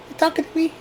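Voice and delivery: high, soft hammed-up De Niro line; De Niro impression. Vlogger playfully quoting De Niro, high and soft. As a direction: squeak out a mock De Niro challenge. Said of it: "I get a kick out of saying that".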